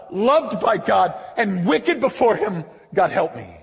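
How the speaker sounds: loud, slow plea for grace; a hint of an earnest confession; lightly voiced pleading believer. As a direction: beg God for help as a believer. Believer's confession quoted in sermon.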